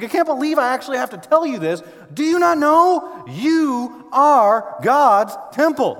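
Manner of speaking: high, loud, slow annoyed disbelief; frustrated Paul. Retold or imitated. Paul scolding the Corinthians, frustrated and loud. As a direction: drag out the annoyed question. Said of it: "Paul's frustrated. He's annoyed".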